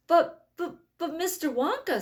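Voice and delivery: high, loud, tripping stammer. A narrator's reading. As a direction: stumble over the words in shock. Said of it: "stammered Grandpa Joe".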